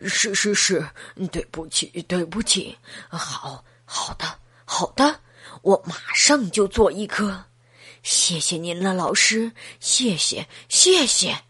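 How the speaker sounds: flustered father on the phone, nearly breaking; nervous. Acted. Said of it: "阿志爸爸很紧张". (紧张的爸爸在电话里连声道歉)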